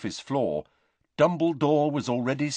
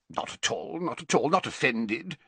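mildly gruff, higher character voice; 6 semitones higher than usual. A narrator's reading. Professor waves away pupil's apology, gruff. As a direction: brush off the apology a little gruffly. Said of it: "said Slughorn gruffly".

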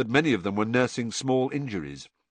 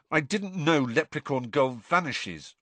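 usual speaking voice

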